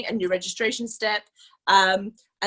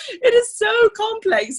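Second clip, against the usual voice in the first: high, loud and slow, stretching it out in laughing despair; exasperated; 11 semitones higher, 7 dB louder, slower than usual. Real, not acted. Presenter venting to the team about the website, high and loud in exasperation. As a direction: lean into it like it's driving you mad.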